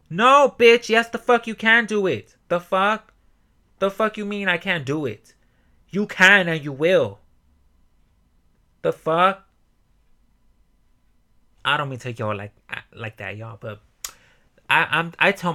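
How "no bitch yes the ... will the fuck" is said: much higher and louder, fired-up yelling; shouting at myself. Acted. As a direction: yell down your own self-doubt. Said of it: "excuse for the shouting".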